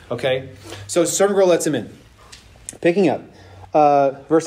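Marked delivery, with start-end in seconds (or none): none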